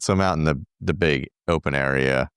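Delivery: ordinary talk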